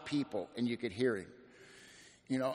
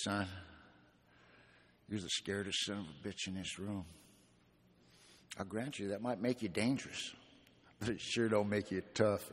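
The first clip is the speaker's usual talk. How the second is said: put-on lower, softer gravelly old-timer voice; imitating gravelly old man; 4 semitones lower, 7 dB quieter than usual. Old gangster telling the young drunk he's scared, low gravelly voice. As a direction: lean into Charlie's low, gravelly growl, softly. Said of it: "the deepest, graveliest voice".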